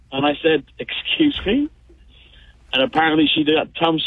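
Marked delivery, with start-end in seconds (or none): 0.8-1.7 high, startled mock disbelief; surprised excuse me. Caller retelling his stunned reply to his wife, high and startled. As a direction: squeak it out, caught off guard. Caller's reaction to wife's outburst.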